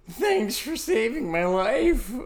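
high, slow, put-on grateful voice; mock grateful. Thanking Coy for saving him, in a high, slow, put-on voice. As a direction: gush out thanks slowly in a silly high voice. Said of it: "But I was like".

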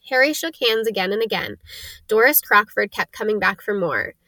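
plain reading voice

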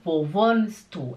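WOHNST DU run together without a separate T; measured model, "wohnst" flowing straight into "du"; natural linked pronunciation. Shown as a demonstration. Teacher repeating the question for learners, linked without T. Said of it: "você fala".

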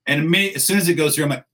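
ordinary delivery

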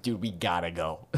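low, soft drowsy voice; sleepy mumble. Retold or imitated. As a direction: mumble it half asleep. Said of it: "I'm like".

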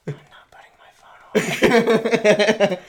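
whispered slow taunt; mock whisper. Kid whispering taunts close to the cop, whispered. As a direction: whisper it right in his ear.

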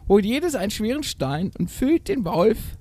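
high, slow theatrical instruction; mother admonishing. A narrator's reading. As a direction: instruct the kids as mother goat. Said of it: "mahnte die Mutter". (hoch und gedehnt mahnende Geißenmutter)

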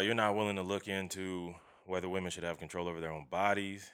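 ordinary delivery

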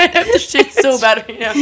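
high, loud, gasping with laughter; laughing too hard to talk; helpless laughter. Real, not acted. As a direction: squeeze the words out between laughs. Both cracking up over the accident.

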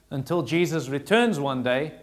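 ordinary talk, nothing marked

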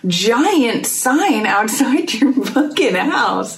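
high-pitched, exasperated disbelief with a laugh; incredulous questioning. Acted. Friend addressing the absent sign owners, incredulous questioning. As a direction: demand an explanation, pitch swooping up. Exclaiming at neighbours' odd sign.

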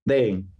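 "Dayton" with the T dropped to a glottal catch; local pronunciation. Shown as a demonstration. Guest practises for the host, "Dayton" without the T. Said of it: "Dayton, perfect".

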